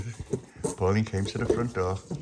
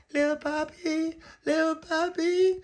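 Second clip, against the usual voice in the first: put-on very high, slow sing-song child calling; 3 dB louder than usual. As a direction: call through the door like a small girl.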